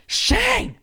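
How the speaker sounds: high, drawn-out protest; exasperated. Retold or imitated. Wife to Shane waking her with a light, exasperated. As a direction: call him out in slow, high-pitched disbelief. Retold exasperated bedtime complaint to husband.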